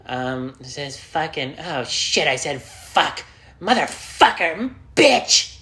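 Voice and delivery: builds from hesitant to a high screaming curse; escalating mock tirade of curses; cursing outburst. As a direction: start sheepish then explode into yelled swears.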